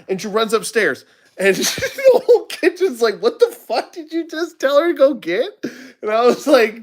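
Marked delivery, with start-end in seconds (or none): 1.4-5.5 high laughing quote of the crew; laughing disbelief. Retold or imitated. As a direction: laugh out the crew's shocked question.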